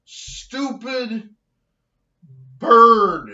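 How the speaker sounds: loud, punched rant words; angry insistence. Real, not acted. Telling off the blue jay, loud and angry. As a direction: stamp each word out. Ranting about bird eating food.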